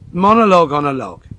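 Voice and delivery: loud, proclaimed show title; title announcement. Real, not acted. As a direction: announce it loudly like a show opener.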